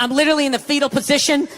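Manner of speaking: ordinary talk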